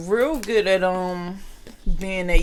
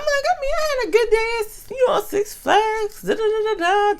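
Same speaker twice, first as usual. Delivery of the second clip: pitched way up, playing innocent; innocent high voice; 4 dB louder than usual. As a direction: squeak it out like a kid with nothing to hide. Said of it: "I'm like".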